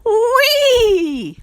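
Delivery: high neighing whinny like a real horse. A narrator's reading. Boy showing off to classmates, realistic horse whinny. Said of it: "Joey let out a loud horse whinny".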